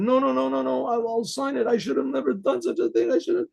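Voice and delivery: high, flustered self-reproach; agitated remorse. Retold or imitated. Rabbi insisting he will sign after all, flustered.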